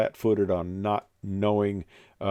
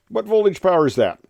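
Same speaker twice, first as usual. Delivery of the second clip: higher and louder, pressing the question; insistent repeat; 9 semitones higher, 6 dB louder than usual. Retold or imitated. Oral examiner repeats his question, higher and louder.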